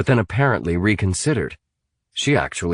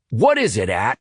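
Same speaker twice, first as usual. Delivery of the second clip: barked; 3 semitones higher than usual. A narrator's reading. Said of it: "barked his brother".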